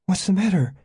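high, anxious question; urgent high plea. A narrator's reading. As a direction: ask in high-voiced alarm. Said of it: "Janet tugged at him urgently".

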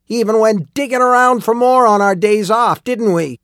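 high-pitched, heated bitter rant; furious ranting. A narrator's reading. Old miner telling lawmen how he was fooled, furious ranting. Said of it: "He was furious".

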